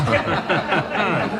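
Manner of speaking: a quick amused chuckle; short laugh. Acted. Inspector laughs at Crocker's excuse about his eye, a quick amused chuckle.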